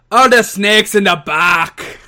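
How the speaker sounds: loud, slow mock Irish accent; Finn Balor. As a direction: belt out a drawn-out, hammy Irish brogue. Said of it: "now do your Finn Balor".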